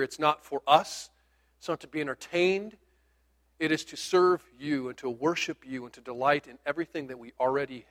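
hushed pastor's prayer before the sermon. Acted. Leads the opening prayer.

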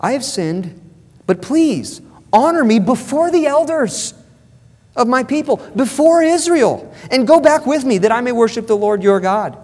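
high-pitched, insistent plea to save face; desperate mock begging. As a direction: plead hard, stretching and pushing the key words. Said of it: "He's begging".

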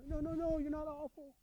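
put-on high, soft parent-to-child voice; hushed, high-pitched comforting; cooing reassurance. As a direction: imitate parents soothing an upset child. Parents retold reassuring crying child.

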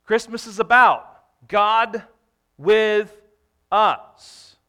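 slow, loud proclamation, each word held apart; proclaiming. Real, not acted. Preacher to congregation: loud, slow proclamation.

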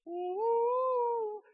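high, loud sung ooh like angels; heavenly choir ooh. Retold or imitated. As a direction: belt a soaring heavenly ooh. Heavens part in Monty Python.

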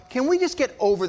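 imitating an impatient kid; pro forma impatience. Shown as a demonstration. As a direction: push to get the formality over with. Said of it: "there's the pro forma".

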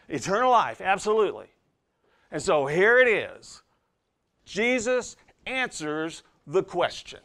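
high, loud pulpit emphasis; emphatic preaching. Real, not acted. Preacher pointing listeners to the passage's highlight, emphatic preaching. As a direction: build to the key point with ringing force.

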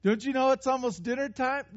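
high mocking singsong; mock disciples. Disciples telling Jesus the obvious, mock-nagging. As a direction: tell Jesus the time, pointedly. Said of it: "they were telling him what time of day it was".

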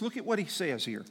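normal talking voice

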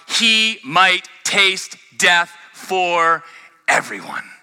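emphatic, deliberate reading with long pauses; scripture reading. Acted. Preacher reads to congregation of suffering and brothers, deliberate scripture reading. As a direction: start high and forceful, settle into slow weighty phrases.

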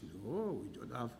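lightly voiced Welsh accent; a hint of a soft Welsh lilt. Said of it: "in our Welsh accent".

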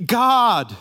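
"God" ringing out high and loud; long, emphatic cry of "God"; stress on GOD. Shown as a demonstration. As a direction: land "God" as the new subject. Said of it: "the subjects changed in verse fifteen".

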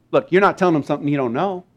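normal talking voice

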